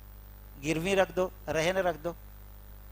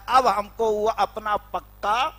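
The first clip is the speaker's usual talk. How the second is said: ordinary talk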